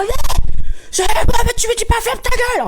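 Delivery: very high, loud garbled yell; mock incoherent screaming. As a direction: scream it like a raging driver. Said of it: "il commence à crier".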